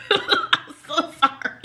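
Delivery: high squeaky laugh-talk; helpless laughing. Real, not acted. Apologizing to little siblings, laughing helplessly. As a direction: squeeze the apology out through laughter, slowly.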